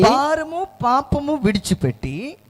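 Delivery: loud, slow, drawn-out scripture call; proclaimed verse. Real, not acted. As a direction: raise the pitch and stretch each word out. Echoes the reader's verse.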